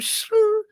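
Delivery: high shrill "Shoo" screech noise; tire screech. Retold or imitated. Man retelling to friends, imitating the truck's screech.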